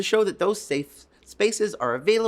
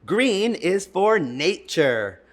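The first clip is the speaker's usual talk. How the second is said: put-on reading to children; 3 semitones higher, 4 dB louder, much slower than usual. Reading a page aloud.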